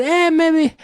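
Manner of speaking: high, wavering mock hesitation; hesitant backing down. Mocking officials who back off from the armed, nervously. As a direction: squeak out a nervous maybe. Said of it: "to make them say".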